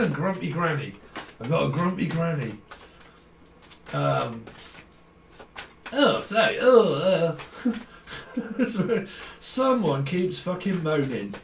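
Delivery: ordinary talk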